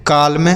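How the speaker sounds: "काल्म" with an audible L before m; L wrongly sounded. Shown as a demonstration. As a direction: demonstrate the mistake "काल्म", sounding the L. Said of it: "अगर आप ऐसा पढ़ देते हैं".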